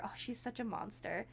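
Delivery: ordinary talk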